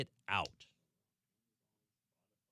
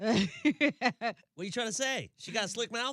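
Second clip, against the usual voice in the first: higher, slow mock-offended tease; drawn-out, pitched-up mock indignation; mock offense; 11 semitones higher, 4 dB louder, slower than usual. Real, not acted. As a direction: play it up as a mock-insulted cohost. Teasing mock-offended reply to cohost.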